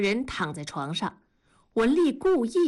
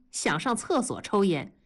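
usual speaking voice

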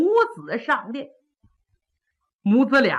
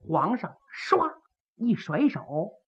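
ordinary delivery

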